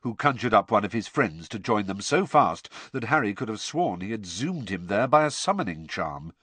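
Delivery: ordinary delivery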